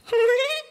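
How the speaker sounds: high loud ghost laugh in one breath; kuntilanak cackle. Retold or imitated. Imitating the kuntilanak's laugh, shrill loud cackle. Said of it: "suara kuntilanak ketawa".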